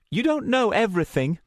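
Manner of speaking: high, edged retort; heated, high-pitched contradiction; angry challenge. Acted. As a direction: push back angrily, pitched high. Luna's quarrel with narrator escalating.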